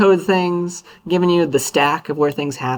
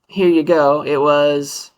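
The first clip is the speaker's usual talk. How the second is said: ordinary talk, nothing marked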